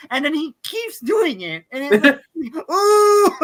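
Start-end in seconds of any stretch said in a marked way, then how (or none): 2.7-3.3 mock yelp. Imitating character smashing glass.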